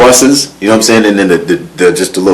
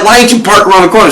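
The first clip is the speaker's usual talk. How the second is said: mock scolding; 11 semitones higher, 4 dB louder, faster than usual. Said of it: "he was like".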